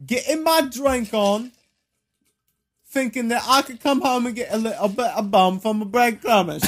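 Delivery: hammed-up Cockney complaint; mock Cockney accent. Home from the pub, complaining in mock Cockney. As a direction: grumble like a stage Cockney. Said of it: "like, a Cockney accent".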